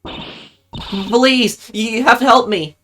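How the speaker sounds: high, stammering plea for help; frightened plea. Acted. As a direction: play someone scared and begging. Frightened character begs for help.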